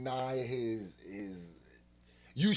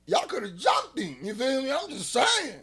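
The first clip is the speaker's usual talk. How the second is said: high, loud hype; egging them on, loud and high; pitched-up teasing hype; excited; 9 semitones higher, 9 dB louder than usual. Real, not acted. Hyping up a fight.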